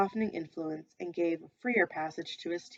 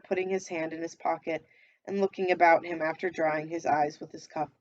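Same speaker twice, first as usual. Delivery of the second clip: plain reading voice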